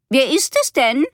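called from afar. A narrator's reading. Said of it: "rief Tante Margarete aus dem Esszimmer".

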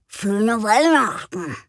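slow, odd creature greeting; strange animal voice. Acted. The reindeer-creature greeting Frieda, slow and odd. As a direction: say it slowly like a strange beast.

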